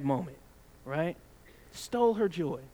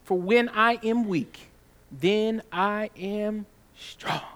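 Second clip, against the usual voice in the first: slow, raised, solemn scripture reading; 4 dB louder, slower than usual. Acted. Pastor reading scripture to his congregation, slow and raised. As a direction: read each word out like holy writ.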